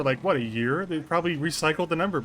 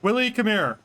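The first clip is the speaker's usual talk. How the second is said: higher, louder call across the room; 7 semitones higher, 4 dB louder than usual. Real, not acted. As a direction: call someone from the next room. Calling to Willie.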